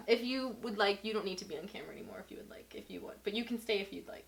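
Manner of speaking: soft unvoiced whisper, fast. Real, not acted. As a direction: whisper an aside to him. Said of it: "whispering to partner off camera".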